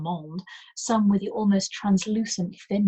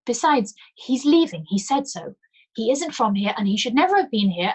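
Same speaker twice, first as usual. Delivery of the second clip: rising panic; 6 semitones higher, 6 dB louder than usual. Acted. Panicked denial continues.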